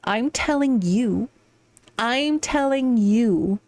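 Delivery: slow, drawn-out "I'm telling you"; emphatic insistence. Real, not acted. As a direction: stretch "telling you" out firmly, word by word. Pointedly turning advice back on Luca.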